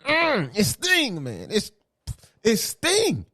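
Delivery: high, pained mock whimper of hurt; imitating LeBron's mock hurt, high and pained. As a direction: squeeze it out like it really stings. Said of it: "LeBron said it hurt".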